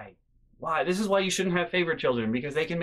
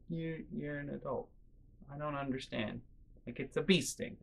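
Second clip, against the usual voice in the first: much softer, flat reply; flat deadpan; 11 dB quieter than usual. Retold or imitated. Brother answering the whining sister, soft and deadpan. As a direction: mutter it back, unimpressed. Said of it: "And I'm like".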